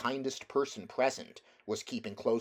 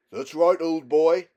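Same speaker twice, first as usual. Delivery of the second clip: loud, higher, hearty London voice; cabby soothing horse; 7 semitones higher, 12 dB louder than usual. A narrator's reading. Cabby calming Strawberry after the fight, hearty soothing voice. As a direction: pat and praise the horse.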